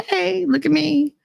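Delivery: high, preening call for attention; showoff cervix voice. Pretend. Imagining her cervix in a tutu, high preening call. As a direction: play a show-off begging to be seen.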